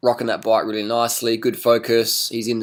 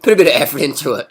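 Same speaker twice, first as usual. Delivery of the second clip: louder and faster, higher, pushing the rider; exasperated urging; 4 semitones higher, 6 dB louder, faster than usual. Acted. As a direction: hurry him on with a raised, fed-up voice. Coach urging a slack rider.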